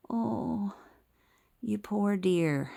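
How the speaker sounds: high, drawn-out mock sympathy; sheepish pity. Doctor to a new cancer patient, imitated in sheepish pity. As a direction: imitate pitying someone, slowly and high. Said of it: "sheepishly looking at me like".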